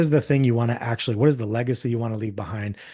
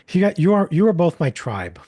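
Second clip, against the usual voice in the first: excited; 6 semitones higher, 6 dB louder than usual. Real, not acted. Gushing about new friends.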